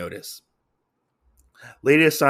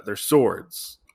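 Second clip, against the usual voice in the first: normal talking voice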